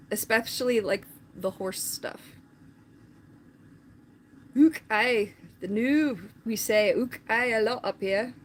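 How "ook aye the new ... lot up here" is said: put-on Scottish accent that slips; attempted Scottish accent. Streamer echoing a Scottish phrase to chat, wobbly Scottish accent. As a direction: have a rough go at a Scottish accent. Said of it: "into a different accent".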